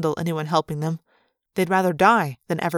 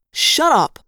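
loud, high angry snap; sudden irritated outburst; snapped; 8 semitones higher, 6 dB louder than usual. A narrator's reading. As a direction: cut them off sharply. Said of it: "Chapman snapped".

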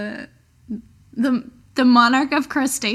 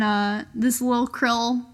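usual speaking voice

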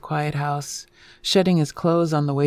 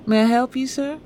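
higher-pitched, gentle Southern nurse; gentle Southern accent; 9 semitones higher than usual. A narrator's reading. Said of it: "The gentle southern intonation of the nurse".

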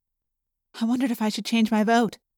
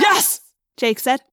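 frantic go-ahead; 3 semitones higher than usual. Acted. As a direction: snap out a desperate yes. Frantic go-ahead to slice it open.

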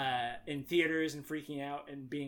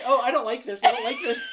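loud, high panic through laughter; hammed-up frightened protest, climbing higher; mock panic; 11 semitones higher, 14 dB louder, faster than usual. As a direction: act out rising dread, laughing. Retelling friends panicking at DVD.

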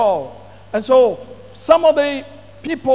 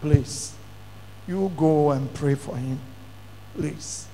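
softer, begging quietly; a hushed, imitated plea; retold soft pleading; 7 semitones lower, 10 dB quieter, slower than usual. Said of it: "He pleaded".

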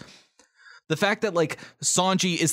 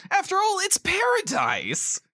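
much higher, beaming; excited hero. Acted. Captain praising the Grand Line, thrilled. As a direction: sing out the word paradise. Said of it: "playing the various characters".

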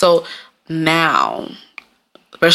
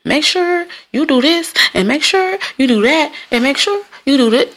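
mock advice-giver; 8 semitones higher than usual. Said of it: "motherfucker was like".

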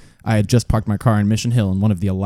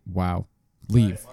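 ordinary delivery

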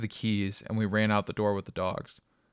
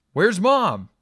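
higher, louder desperate shout; urgent shout; 11 semitones higher, 11 dB louder than usual. A narrator's reading. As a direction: shout over the fire. Urgent question in the fire.

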